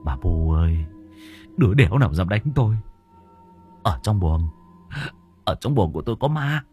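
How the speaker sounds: panicky, rising cry for help; frightened son begging grandma Bu for help; scared son. Acted. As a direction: babble in fear of the ghost. Drunk son frightened by ghost.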